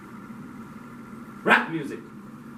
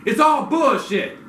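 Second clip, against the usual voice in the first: angry outburst; 11 semitones higher, 7 dB louder, slower than usual. Real, not acted.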